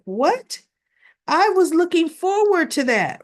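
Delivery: mock let-down over the spilled lunch; disappointed surprise. Woman whose lunch spilled, retold with mock dismay.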